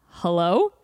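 lightly voiced caller checking who's there; puzzled caller. Dude calling through elevator speaker, questioning hello. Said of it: "a dude starts talking to us".

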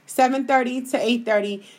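ordinary talk, nothing marked

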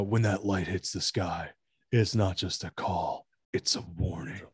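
low, quiet growl of Batman's line; lightly voiced menacing Batman; a hint of a brooding vigilante warning; imitating Batman. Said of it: "The way he says it's a warning".

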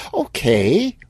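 high, rising cheery okay; sing-song HR brightness; bright. Acted. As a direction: chirp it with a lift at the end. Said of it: "Lucy said brightly".

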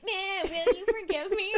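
put-on high, slow baby voice. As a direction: read it like a whiny baby. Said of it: "I was reading it in this baby voice".

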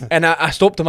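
ordinary delivery

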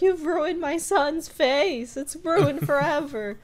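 high, loud, slow wail of a furious mother; milk the outrage, drawn out and shrill; outraged mom. Retold or imitated. Outraged mom over son's face.

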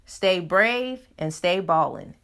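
ordinary delivery

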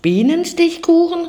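high excited cry; cried out. A narrator's reading. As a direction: burst out with delight. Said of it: "rief der kleine Tiger". (hoher freudiger Ausruf)

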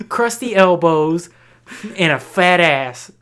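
drawn-out, emphatic comic roll call; performed joke routine. Acted. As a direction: recite it like a hype bit, hitting each item. Performs an old high school joke.

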